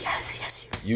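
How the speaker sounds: breathy hushed whisper. Retold or imitated. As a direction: whisper it, aroused. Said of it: "I'm way more aroused".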